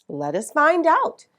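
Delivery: ordinary delivery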